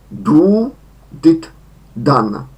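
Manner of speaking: did, done, with done said with a short A; English verb forms. Shown as a demonstration. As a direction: model did and done for students. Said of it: "trzecia forma krótkie a". (did, done z krótkim a)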